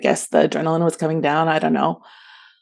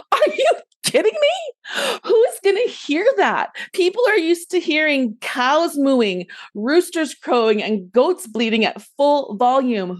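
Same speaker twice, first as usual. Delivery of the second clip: high, drawn-out exasperated disbelief; incredulous outburst; 8 semitones higher than usual. Retold or imitated. Storyteller reacts to companion's feeble yelling, incredulous. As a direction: start very high and stretched, settling as the complaint goes on.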